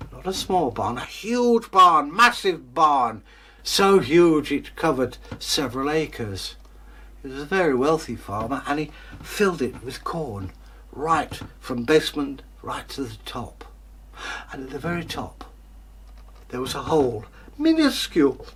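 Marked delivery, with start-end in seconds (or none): 1.0-3.2 huge and massive stretched out loud and slow; emphasis. A narrator's reading. Narrator stressing how big the barn is, loud and slow. 17.6-18.5 minuscule squeaked very high; tiny squeak. A narrator's reading. Narrator describing a tiny hole, squeaking high.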